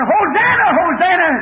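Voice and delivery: high-pitched crowd cry of praise; acted high cheer; calling out. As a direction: call it out like one in a crowd. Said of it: "Others calling".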